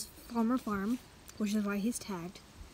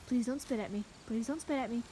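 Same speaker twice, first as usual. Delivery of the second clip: ordinary talk, nothing marked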